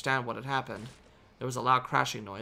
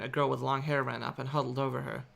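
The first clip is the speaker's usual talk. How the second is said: ordinary delivery, nothing marked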